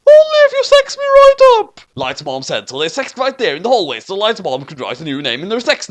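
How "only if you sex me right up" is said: very high, loud squeaky mom voice; falsetto mom. Acted. Light's mom greeting the doorman. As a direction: squeak it comically.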